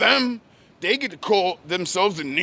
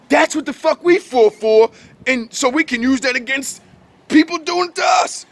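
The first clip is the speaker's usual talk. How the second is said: rising, louder angry outburst; angry rant; 6 semitones higher, 3 dB louder than usual. Real, not acted. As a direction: let the anger boil up, pitch climbing. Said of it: "This is why I get angry".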